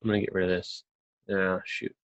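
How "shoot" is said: ordinary talk, nothing marked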